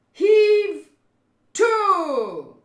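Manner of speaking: loud, high, drawn-out call; long ship's-order holler; shouted command. Acted. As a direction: bellow each word to a crew. Boss calling out orders.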